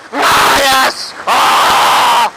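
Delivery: loud, high roar of pleasure; whooping, breath-heavy release of joy; exultant shout. Real, not acted. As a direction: yell with delight into the wind. Whooping while riding in sea breeze.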